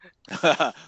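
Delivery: loud, high burst of laughter; big high-pitched laugh. Real, not acted. Teased about giving ending away.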